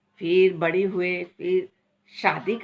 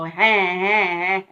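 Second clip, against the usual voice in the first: imitated baby whine; 6 dB louder, slower than usual.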